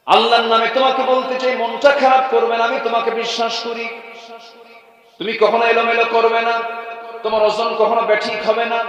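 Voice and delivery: high, loud declamatory sermon chant; ringing preacher's delivery; full-force preaching. Acted. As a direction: proclaim it to the whole gathering on a high held pitch.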